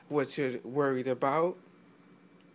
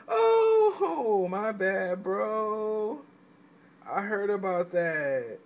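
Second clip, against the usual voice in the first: sheepish apology; 3 semitones higher, much slower than usual. Retold or imitated. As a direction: giggle it out like you got caught. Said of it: "You gonna be like".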